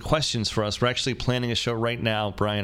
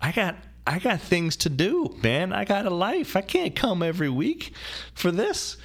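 exasperated protest; 9 semitones higher, slower than usual. Retold or imitated. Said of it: "And I was like".

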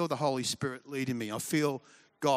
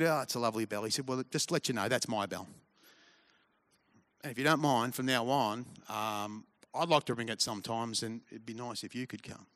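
gentle God voice; 4 semitones lower, 5 dB quieter than usual. Acted.

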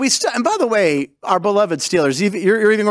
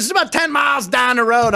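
put-on Pittsburgh accent; 7 semitones higher than usual. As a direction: call it out in exaggerated Pittsburgh dialect. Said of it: "We have this accent in Pittsburgh".

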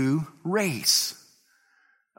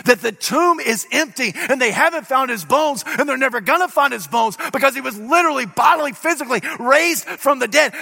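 full-force preaching; 6 semitones higher than usual. Acted. Preacher proclaiming the resurrection to his congregation, full-force preaching. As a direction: preach it hard to the crowd.

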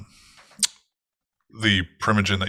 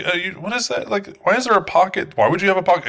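much higher, playing dumb about the pocket; sweetly curious, pitched way up; mock innocent curiosity; 11 semitones higher, faster than usual. The woman asking Lucy about the belt pocket, high and innocent. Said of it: "and it's like".

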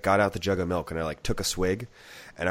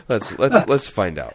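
normal talking voice

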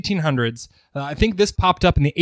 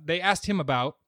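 soft reading aloud; 6 dB quieter than usual. Acted. Reads a historical newspaper passage.

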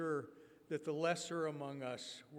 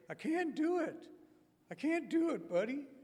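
much higher, louder, pleading refusal; Robert's high voice; 11 semitones higher, 8 dB louder than usual. Retold or imitated. Preacher voicing Robert turning down kickball, high and pleading. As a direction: squeak out the refusal shyly. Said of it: "He'd say".

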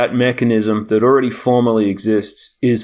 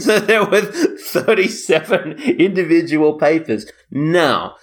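talking through laughter, higher and slow; laughing; 6 semitones higher, slower than usual. Real, not acted. Telling colleague about thirty-seven individual papers, laughing. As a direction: laugh your way through the words.

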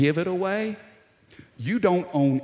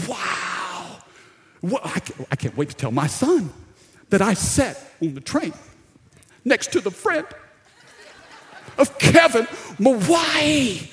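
starstruck fan; 5 semitones higher than usual. Retold or imitated. Train stranger gushing to the pastor, starstruck and building to a laughing shout. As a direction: gush like a fan who just met a celebrity's friend. Stranger amazed by Kevin Mawai.

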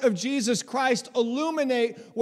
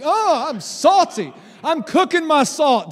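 louder playful try at "cooking my salt"; mock Gen Z hype; 3 semitones higher, 6 dB louder than usual. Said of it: "Did I get it right, Gen Z?".

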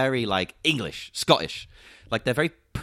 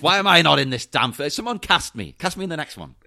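raised, playful protest; mock indignation; 5 semitones higher, 4 dB louder than usual. Person jokes about being left out of a film, mock indignant. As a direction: demand the part in a high-pitched mock complaint.

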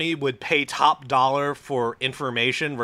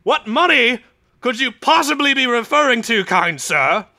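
much higher, louder mock-innocent voice; loud pompous gangster; 10 semitones higher, 6 dB louder than usual. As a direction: play dumb grandly and loudly. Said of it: "Arnie is the one who's loud".

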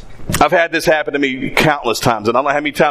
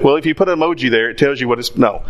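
ordinary talk, nothing marked

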